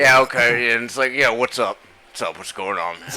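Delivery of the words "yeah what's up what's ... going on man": low, dropped imitation of a friend; lightly voiced bro. Imitating a friend's normal voice, lowered a touch. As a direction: sink the voice to mimic him. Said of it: "It'd be like".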